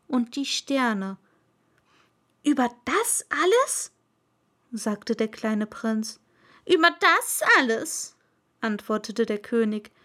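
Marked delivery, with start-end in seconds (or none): 2.4-3.9 put-on higher questioning boyish voice, a bit louder; can't believe it; a touch of wonder in the little prince; astonished prince. 6.7-8.1 high, loud royal order; pompous king's decree; commanding king. Acted. King answers.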